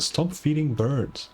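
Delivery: ordinary talk, nothing marked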